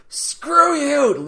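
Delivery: high, stretched-out cry of defiance; imitating a triumphant, defiant holler; mock defiant yell. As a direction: hurl it up at the universe, playfully. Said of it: "he's also like".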